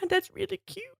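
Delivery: delighted gasp. Real, not acted. Reacts to baby camel.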